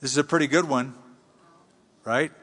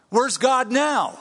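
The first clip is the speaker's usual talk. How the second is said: doubting lament; 7 semitones higher, slower than usual. Pretend. Voicing Gideon's doubt.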